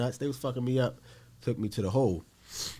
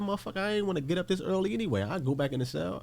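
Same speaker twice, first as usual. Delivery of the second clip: higher-pitched, indignant retort; retold defiance; 10 semitones higher, faster than usual. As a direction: act out brushing off a guard's order. Acted defiant reply to guard.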